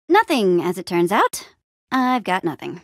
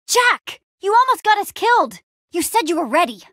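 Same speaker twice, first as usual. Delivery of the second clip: angry scolding; 9 semitones higher than usual. Acted. Scolding Jack after near death.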